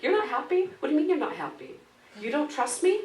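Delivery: high-pitched mock doctor, offended and loud; hammed-up incredulous doctor; indignant doctor. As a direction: press each question like a wounded ego. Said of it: "he goes".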